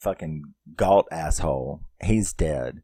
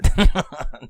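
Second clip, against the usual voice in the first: short, high, loud laugh; real delighted laugh; amused laugh; 9 semitones higher, 8 dB louder than usual. Real, not acted. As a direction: burst out laughing for a moment. Amused by repeating the quote.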